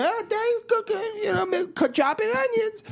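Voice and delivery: put-on high, sing-song baby talk; cooing to the baby while cooking; high-pitched talk to an infant. Voicing himself to baby.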